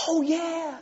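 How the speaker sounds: high, bright-eyed churchgoer gushing; lightly voiced enthusiastic stranger; eager visitor voice. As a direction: gush like a delighted new visitor. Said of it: "You want people to say".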